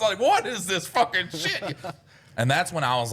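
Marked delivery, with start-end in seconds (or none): 0.2-1.8 high, drawn-out incredulous cry; mock disbelief. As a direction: retell baffled shock, laughter breaking in. Retold shock at vomited water.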